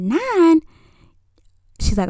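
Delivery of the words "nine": shocked. Retold or imitated. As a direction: react like the curfew is outrageous. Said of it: "I said".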